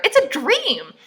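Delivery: high, loud exclamation; exasperated outburst. Real, not acted. Reader heckling the book's dream passage, exasperated outburst.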